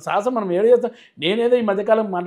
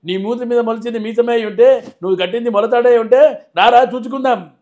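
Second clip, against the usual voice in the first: higher, louder, menacing hero punch dialogue; Balayya impression; 4 semitones higher, 6 dB louder than usual. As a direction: hurl the threat like Balayya on screen. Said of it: "voice ditto Balayya Babu లాగా ఉంది".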